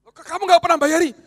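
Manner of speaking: exasperated outburst; indignant mock shout. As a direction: snap back at the freeloading friend. Friend complaining about never paying.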